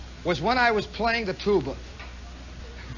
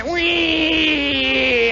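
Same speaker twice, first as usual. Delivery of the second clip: long, high engine squeal; loud whee of a plane flying past; airplane whine; 7 semitones higher, 5 dB louder than usual. Retold or imitated. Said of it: "they would go".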